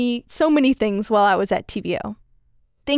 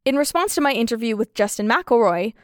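ordinary talk, nothing marked

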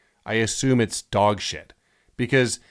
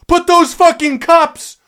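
high, loud outraged rant; exasperated yelling; 9 dB louder than usual. Acted. Thirsty customer raging about the hidden cups, high exasperated yell. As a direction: yell it at full indignant pitch. Outburst over withheld cups.

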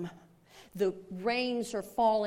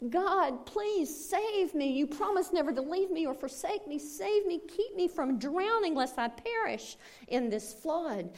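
much higher, pleading cry to God; frantic prayer; 8 semitones higher than usual. Acted. Man on his roof begging God to save him, high and desperate. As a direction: beg heaven, voice climbing.

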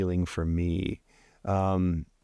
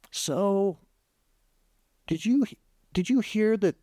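dad's question lightly voiced, pitched much higher; a hint of the father's tentative opening; 11 semitones higher than usual. Dad's retold sudden question.

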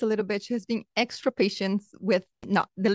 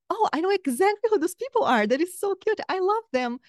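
higher, delighted gushing; replaying a joyful surprise, pitched up; retold delight; 7 semitones higher than usual. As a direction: gush happily over friends back home. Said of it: "And I was like".

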